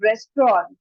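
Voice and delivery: "restaurant" as RES-tront; correct pronunciation. Shown as a demonstration. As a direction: say "restaurant" in two syllables with an O sound. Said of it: "but it is wrong". ("restaurant" को रेस्ट्रॉन्ट बोलें)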